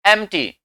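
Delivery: "एम्प्टी" said em-tee, p dropped; silent p. Shown as a demonstration. Teacher repeating the quiz answer, p left silent. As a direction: leave out the p in "एम्प्टी". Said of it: "क्या बोला जाएगा".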